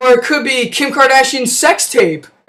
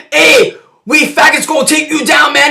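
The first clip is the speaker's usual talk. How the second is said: tough gang voice; 3 semitones higher than usual. Retold or imitated. Gang threatening hero.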